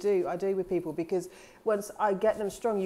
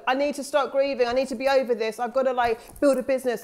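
higher, louder insistent self-scolding; pressuring self-talk; 5 semitones higher, 5 dB louder than usual. Acted. Speaker mimics pressuring herself to get over grieving, insistent and raised. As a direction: push yourself with urgent demands.

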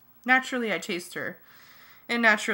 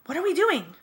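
high, raised-voice yell; alarmed yell; 9 semitones higher, 5 dB louder than usual. A narrator's reading. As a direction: yell it out as you chase after him.

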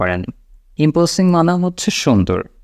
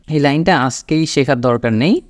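ordinary delivery

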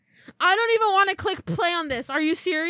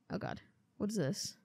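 usual speaking voice

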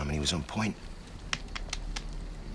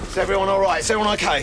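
loud, higher urgent call; 13 semitones higher, 5 dB louder, much faster than usual. Acted. Sergeant calls out to his men after the attack, urgent shout. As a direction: shout the check to the whole squad.

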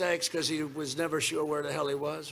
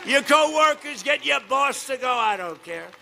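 booming campaign rally delivery; rally speech; 5 semitones higher, 3 dB louder, slower than usual. Acted. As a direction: shout it to a packed arena.

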